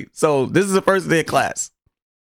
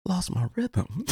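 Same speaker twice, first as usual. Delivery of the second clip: mock sad; 5 semitones higher, 9 dB quieter, slower than usual. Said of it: "looking sad".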